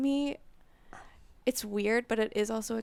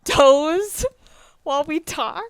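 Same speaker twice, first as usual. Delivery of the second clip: teasing; 6 semitones higher, 13 dB louder, slower than usual. Real, not acted. Teasing husband about his toes.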